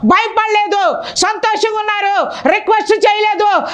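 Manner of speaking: impassioned preacher; fervent preaching. Acted. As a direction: preach it out to the congregation. Preaching at full force.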